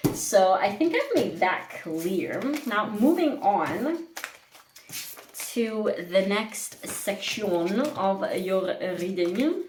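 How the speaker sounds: slow, drawn-out lilting fake French accent; playful, accidental not-quite-French accent; bad mock-French accent. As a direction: put on a horrible French accent, stretching the words. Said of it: "I don't know why I'm speaking like that".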